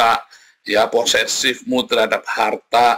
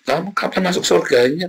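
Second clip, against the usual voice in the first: ordinary talk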